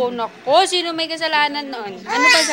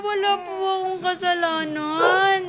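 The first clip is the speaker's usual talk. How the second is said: mock protest; 4 semitones higher, 3 dB louder than usual. Parent claims innocence to shouting baby, mock protest. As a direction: protest your innocence playfully, pitched up high.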